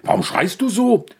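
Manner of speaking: hoch und gereizt schimpfen; empörter Ochse. Acted. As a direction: den Esel aufgebracht anfahren. Ochse schimpft über den Esel. (Ochse fährt den Esel wegen des Lärms empört an)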